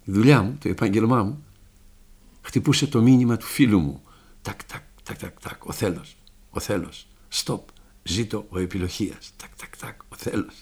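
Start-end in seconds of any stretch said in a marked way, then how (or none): 4.4-10.5 hushed, tapped-out syllables; imitated Morse tapping. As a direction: murmur it like a coded message being tapped. Imitating his tapped Morse message.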